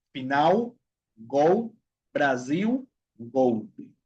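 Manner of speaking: final, gol, Brasil, golpe as "finau, gou, Braziu, goupi"; each of final, gol, Brasil, golpe apart, L rounded to u. Shown as a demonstration. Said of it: "se pronuncia la L como la U".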